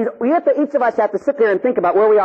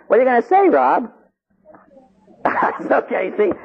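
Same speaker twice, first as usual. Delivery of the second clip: ordinary talk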